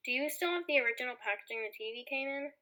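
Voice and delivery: normal talking voice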